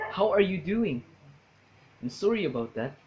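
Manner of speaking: imitated Canadian accent, apologetic. Shown as a demonstration. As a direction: put on a mild Canadian accent. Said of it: "For example".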